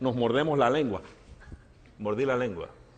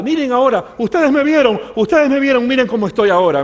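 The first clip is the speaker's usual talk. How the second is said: loud, high, exultant repetition; acted-out amazed witness to a crowd; excited testimony; 10 semitones higher, 9 dB louder than usual. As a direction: shout it out as living proof. Healed man shows himself to crowd.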